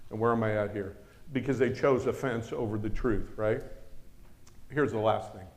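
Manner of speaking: normal talking voice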